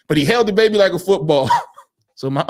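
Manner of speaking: ordinary talk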